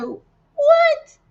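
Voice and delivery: a high, stunned "What?"; shocked what. Retold or imitated. Friend recalls hearing the letter after the vitamin, high and stunned. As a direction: cry out in disbelief, way up high. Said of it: "I said".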